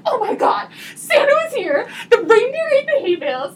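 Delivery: high, loud and laughing with delight; thrilled kids. Retold or imitated. Woman reliving finding Santa's hay as a kid, high excited gushing. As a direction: gush like a child on Christmas morning.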